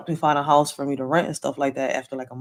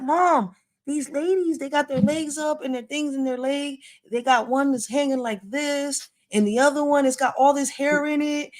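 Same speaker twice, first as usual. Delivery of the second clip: put-on child's voice; 8 semitones higher than usual. Son describing the ladies.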